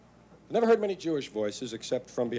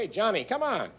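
Irish cop voice; 8 semitones higher than usual. Retold or imitated.

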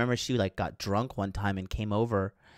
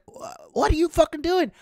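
very high, incredulous mock outburst; stammered high-pitched reenacted objection; retold panicked protest; 3 dB louder than usual. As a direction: act out squealing disbelief. Retold protest while being wrestled.

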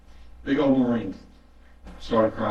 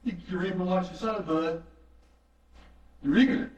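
a lightly voiced, higher mocking inner voice; smug flesh side; 5 semitones higher than usual. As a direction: needle him like your own smug flesh. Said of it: "The flesh side of me said".

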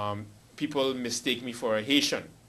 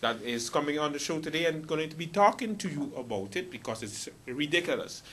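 normal talking voice

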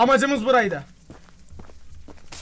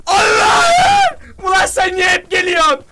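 high, loud cry of exasperation; alarmed shout; 7 semitones higher, 6 dB louder, much slower than usual. Real, not acted. Gamer to pursuer that keeps coming, exasperated shouting. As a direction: scream out, then keep shouting the complaint.

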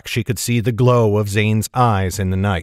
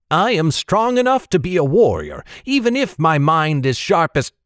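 put-on higher-pitched boy puffing himself up; boastful youngster, pitched up; proud boy; 6 semitones higher than usual. A narrator's reading. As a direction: protest proudly like an eager lad. Boy puffed up, protesting to captain.

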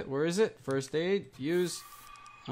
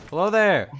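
scared horror game streamer; 6 semitones higher, 9 dB louder than usual. Real, not acted. Zombie appears suddenly.

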